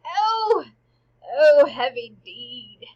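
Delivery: loud, drawn-out theatrical groan of dismay; dramatic lament. Acted. King hears of his friend's killing, loud mock lament. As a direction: wail it slowly, hammed up. Acted king's reaction to the killing.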